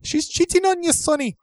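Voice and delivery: squeaky-high mock mother voice; hammed-up falsetto mum, pleading with her boy. Mother telling her son he's cheated on, comic falsetto.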